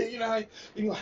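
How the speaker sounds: talking through laughter; laughing. Real, not acted. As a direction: laughs while trying to talk. Laughing mid-sentence.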